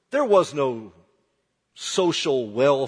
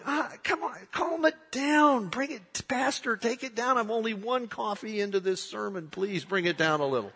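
higher, softer whiny grumble; mock congregation complaint; 6 semitones higher, 5 dB quieter than usual. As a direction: tease the pastor to tone it down. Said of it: "some of you are going".

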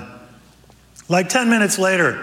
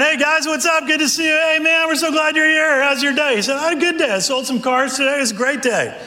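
pumped car salesman; 12 semitones higher than usual. Acted. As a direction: sell the hello like a car dealer. Car salesman friend arrives.

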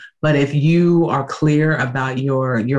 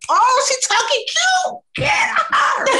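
very high, slow mock voice; old inner voice; slower than usual. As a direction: play the old spank-ready self. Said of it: "in my head, I'm like".

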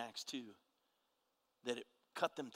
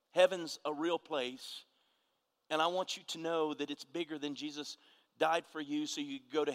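preaching; 4 semitones higher, 7 dB louder than usual. Acted. Preaching at full force.

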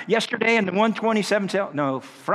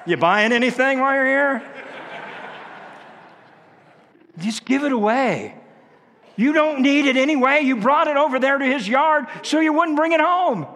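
a hammed-up nagging voice, much higher and louder; mock pushy shopper; 8 semitones higher, 4 dB louder than usual. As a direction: play a pushy neighbour teasing loudly. Acting a bossy yard-sale voice.

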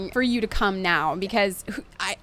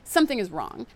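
ordinary talk, nothing marked

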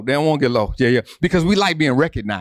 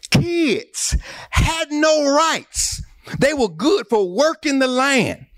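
high sing-song preacher's whoop; whooping preaching; 7 semitones higher than usual. Real, not acted. Preacher listing the humble of Jesus' day, high sing-song whoop.

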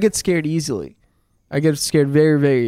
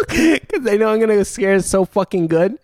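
laughing through the words; can barely get it out for laughing; 4 semitones higher than usual. Real, not acted. Telling his friends about the prank, cracking up. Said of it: "I'm laughing so hard".

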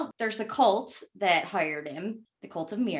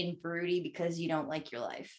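whispering; 6 semitones lower, 10 dB quieter than usual. Real, not acted. Teasing aside about crabbiness.

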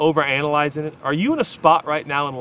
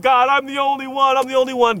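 high anguished plea to God; crying out; 11 semitones higher, 4 dB louder than usual. Retold or imitated. Elijah crying out to God in despair, high anguished plea. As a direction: wail it up high like a desperate prophet. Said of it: "As Elijah cries out".

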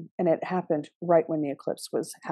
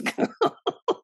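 breathy laugh. Real, not acted. Laughing at the dream.

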